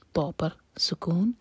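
ordinary talk, nothing marked

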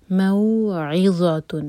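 stretched موعظۃ; tajweed recitation. Shown as a demonstration. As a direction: chant موعظۃ. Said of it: "مو مد لین اور اس کے بعد عین پر زور".

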